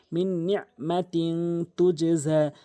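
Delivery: "মিন নিয়া মা তিং তু জে জা" slow, nasals held; recited with ghunnah. Shown as a demonstration. Teacher models the rule for learners, slow held nasals in "মিন নিয়া". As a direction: linger on the hum in "মিন" and "তিং". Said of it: "কিন্তু গুণনা করতে হবে".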